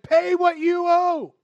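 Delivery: high, loud, slow snarl of a demand; angry demand. Acted. Servant choking his fellow servant, furious demand. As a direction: choke the words out at him.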